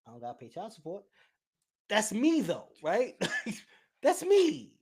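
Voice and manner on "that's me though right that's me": laughing loud admission. Real, not acted.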